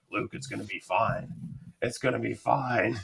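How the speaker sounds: doped-up patient softly reassures his friend; soft reassurance. Retold or imitated. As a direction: soothe him through a morphine haze. Drugged teller comforts crying friend.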